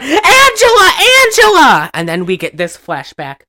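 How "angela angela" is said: high, loud mock yell of a name; calling out. Susie running up to the waterfront, calling out. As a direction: imitate someone yelling across the waterfront. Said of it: "Susie ... runs up to the waterfront".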